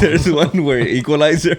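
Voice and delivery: laughing while telling. Real, not acted. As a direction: chuckle through the line. Laughing over a meme.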